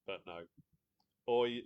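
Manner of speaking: normal talking voice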